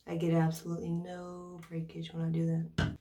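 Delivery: ordinary delivery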